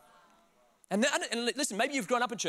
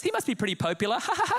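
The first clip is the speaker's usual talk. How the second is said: mock mum cracking herself up; high, silly laugh at your own joke; mum making jokes; 5 semitones higher, faster than usual. Mum joking while she films, corny and giggly. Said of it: "making mum jokes".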